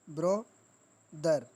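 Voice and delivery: "Bro, दर" in two beats with a long gap; syllable break. Shown as a demonstration. Teacher demonstrating two sounds, bro and दर pulled apart. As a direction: halt after bro, then let दर fall. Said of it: "bro पर एक stop लग रहा है".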